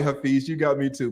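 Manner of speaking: usual speaking voice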